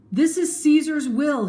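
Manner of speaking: high, loud proclamation; announcing. Acted. Mark Antony announcing the will to the crowd, loud. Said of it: "he announced".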